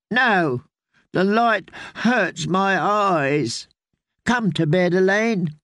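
put-on high, slow, whining little boy; a frail child begging; whiny sick child. Boy lord voiced in story.

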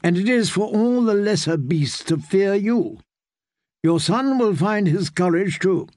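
a hint of a proud older aunt; stern aunt. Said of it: "she said".

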